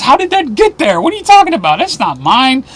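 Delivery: high, squeaky mock denial; mock indignant woman. Host mimics the arrested woman denying it, high mock protest. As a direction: play the caught woman protesting innocence.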